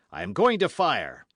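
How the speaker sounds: high, loud announcement; cried warning. Acted. Athos from cellar with gun ready, loud cry. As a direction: call it out as a warning. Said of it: "cried Athos".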